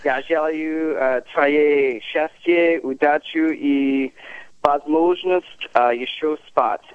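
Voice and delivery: slow, careful Slavic words. Real, not acted. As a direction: say it slowly in Slavic, word by word. Slow wish in Slavic language.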